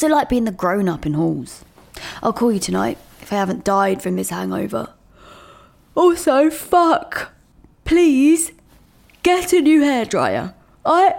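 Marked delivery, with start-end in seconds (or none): 5.9-11.1 urgent plea. Acted.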